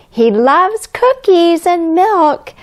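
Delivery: high, slow, sing-song delight. Acted. Teacher to children, sing-song delight. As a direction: gush over Santa's love of cookies. Teacher hyping Santa to kids.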